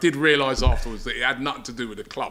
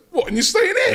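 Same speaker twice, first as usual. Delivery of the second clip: very high incredulous question; mock a stunned clerk, voice leaping high; mimicked disbelief. Hotel man amazed the guest is staying here, high-pitched disbelief.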